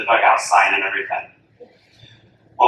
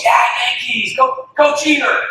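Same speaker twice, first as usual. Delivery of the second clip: team cheer; 12 semitones higher than usual. Retold or imitated. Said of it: "The whole team goes".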